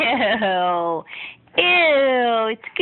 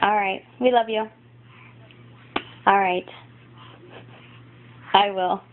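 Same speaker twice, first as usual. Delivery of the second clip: ordinary talk, nothing marked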